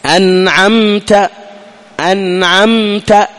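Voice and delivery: أنعمت slow, the meem a brief lip closure; short clear meem. Shown as a demonstration. Teacher demonstrates the brief meem, slow and clear. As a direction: lips just touch on the meem of أنعمت. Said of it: "أطبق الشفتين فقط".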